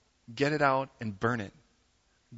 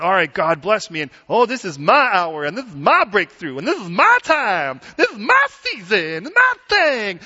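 mock greedy prayer; 5 semitones higher, 9 dB louder than usual. Mocking a self-centred prayer.

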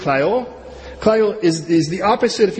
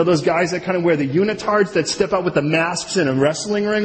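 ordinary delivery

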